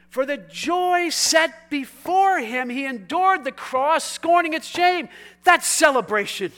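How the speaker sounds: loud, slow scripture proclamation; forceful preaching. Real, not acted. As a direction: proclaim the verse, stretching key words.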